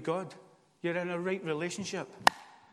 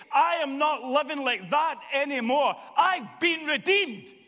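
raised, loud, impassioned sermon; full-force preaching; 8 semitones higher, 6 dB louder than usual. Real, not acted. Preacher declaring freedom from condemnation to the congregation, full-force preaching. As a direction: declare it with rising force, hammering each phrase.

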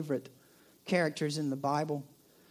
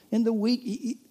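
ordinary talk

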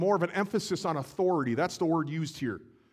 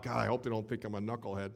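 embarrassed inner thought; 7 semitones lower, 8 dB quieter, faster than usual. Acted. Peter's imagined inner worry.

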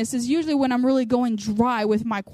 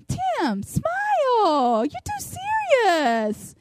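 high, singing cheery coaxing; happy lady; 10 semitones higher, much slower than usual. Retold or imitated. Happy lady telling the salon owner to cheer up, sing-song imitation. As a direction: imitate her sing-song teasing, pitched way up. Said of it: "she looks at the owner".

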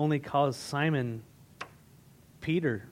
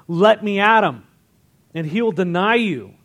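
loud, raised-pitch demand; acted challenge; 4 semitones higher, 8 dB louder than usual. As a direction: hurl it as a challenge to God. Acting Satan challenging God about Job.

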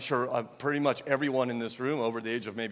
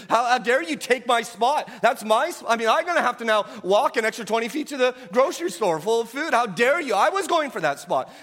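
higher, louder, hammed-up outrage at a stolen spot; mock parking-lot rage; 10 semitones higher, 7 dB louder than usual. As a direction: play the wronged driver, loud and high. Acting out a driver's parking rage.

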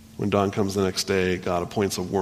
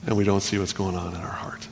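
hushed whisper, softer; whispered confession of the heart; 6 dB quieter than usual. Real, not acted. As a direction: breathe it to the listeners. Quiet reflective aside.

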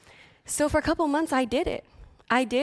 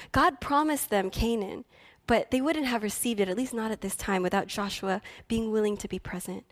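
normal talking voice

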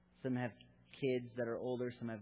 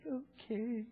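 put-on little girl voice; 11 semitones higher than usual. Daughter's small reply imitated.

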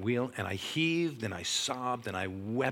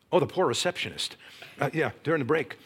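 normal talking voice

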